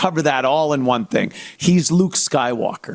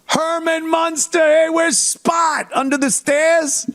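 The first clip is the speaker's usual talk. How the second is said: higher, slowed mock shout; excited fan; 12 semitones higher than usual. Fan shouts to Herman Munster about Spot. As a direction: hail him like a delighted fan.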